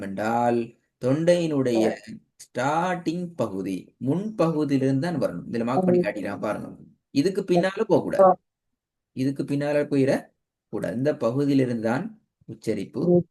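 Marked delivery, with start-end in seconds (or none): none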